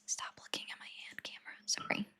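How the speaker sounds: very soft whisper; whispered aside. Real, not acted. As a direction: whisper it under the argument. Hushed aside during debate.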